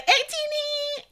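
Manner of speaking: high, slow, doting sing-song; fond. Retold or imitated. Fan cooing the fandom name sweetly. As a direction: coo the name lovingly. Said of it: "And now I'm just like".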